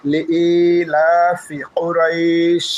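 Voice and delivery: লিইলাফি drawn out very slowly, then কুরাইশ stopped; very slow Quran recitation; stretched recitation. Shown as a demonstration. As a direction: chant it with one alif of stretch. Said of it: "এখানে এক আলিফ টান দেব".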